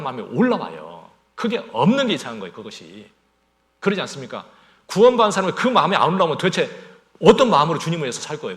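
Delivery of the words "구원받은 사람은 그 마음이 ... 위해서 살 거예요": louder, higher, fast challenge with a laugh; impassioned preaching. Real, not acted. Preacher challenging the saved, loud fast and laughing. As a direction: press the congregation hard, half laughing.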